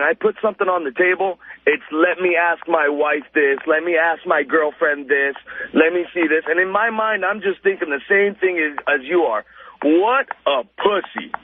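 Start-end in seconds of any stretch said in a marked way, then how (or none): none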